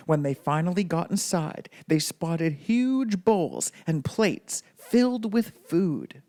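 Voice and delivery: ordinary delivery, nothing marked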